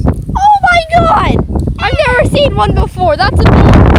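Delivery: high, slow, thrilled gasp; excited squeal. Real, not acted. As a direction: gush over something cute. Sees a tadpole.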